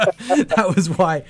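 a sheepish laugh over the words; embarrassed laugh. Real, not acted. Laughing at own mask mishap.

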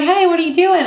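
put-on high Southern-accented question. Woman approaches stranger outside the theater, Southern accent. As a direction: ask it high, with a friendly Southern twang. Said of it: "she had a Southern accent".

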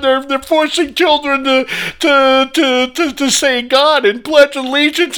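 mocking whiny liberal. Ranting host mocks crying leftists in a whiny high voice. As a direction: sneer at them by whining like a panicked activist. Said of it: "are crying".

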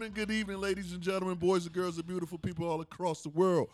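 booming showman intro, high and loud; grand entrance announcement. Acted. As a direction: hype up the crowd like an MC. Podcast host's opening greeting.

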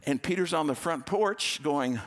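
usual speaking voice

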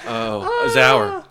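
usual speaking voice

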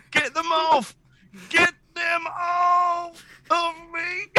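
panicked scream. Acted. Vulture screaming as spiders crawl on his face.

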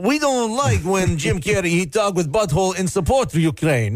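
broken-English mock Russian accent, hammed up; terrible fake Russian accent; hacky Russian accent. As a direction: put on a bad Russian accent like a cartoon villain. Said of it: "those terrible, hacky accents".

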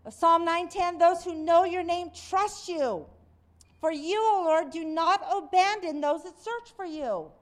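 lifted reading of scripture. Acted. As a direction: read the psalm out like a proclamation. Reads the psalm aloud.

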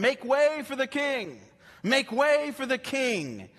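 a herald crying out before the king enters the city; herald announcing. Acted. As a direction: call out like a royal herald clearing the road. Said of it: "forerunners that would go before them".

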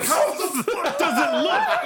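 high, drawn-out mock outrage, laughing; exasperated. Worker's imagined retort to boss, comic exasperation.

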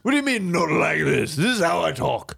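haughty, affected rich-man voice; pompous upper-crust scolding; rich person's talk. Acted. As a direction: look down on a lowly person. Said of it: "This is how a rich person talks".

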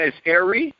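normal talking voice

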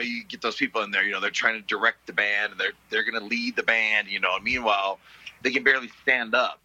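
ordinary talk, nothing marked

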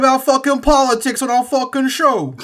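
mock Italian-American mobster rant; Italian-American accent. Shown as a demonstration. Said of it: "say these, like, words in the Italian accent".

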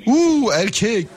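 drawn-out slow high "Uuu, erkek"; mock surprise. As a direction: tease with a long surprised coo. Host mock-surprised at male caller.

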